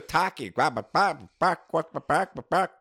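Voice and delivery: lightly imitated Australian twang; mock Australian accent. Co-host mimicking the sister's accent, hint of mock Australian. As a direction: parody an Australian accent on nonsense words. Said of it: "to me it sounded Australian".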